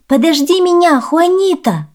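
high, loud plea to wait; little sister's high-pitched shout; girl calling out. A narrator's reading. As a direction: call after someone running ahead. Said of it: "кричит сестра".

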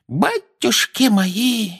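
high, drawn-out theatrical lament; slow, high-pitched dismay; tragic tone. A narrator's reading. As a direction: wail it like a tragedy. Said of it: "сказала она трагическим тоном".

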